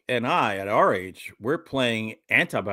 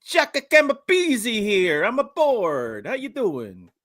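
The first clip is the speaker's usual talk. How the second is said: hammed-up Italian-American tough guy voice; lightly put-on Italian accent; mock Italian guy; 8 semitones higher than usual. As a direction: play a wiseguy greeting the room. Italian guy character intro.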